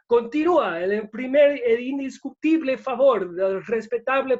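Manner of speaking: booming, nonstop Spanish broadcast patter with rolled r's; best radio voice. Shown as a demonstration. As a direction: do your best over-the-top radio announcer. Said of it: "in my best, like, radio voice".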